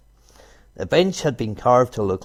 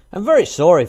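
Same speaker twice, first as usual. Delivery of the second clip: startled fox apology; 8 semitones higher, 8 dB louder, faster than usual. Acted.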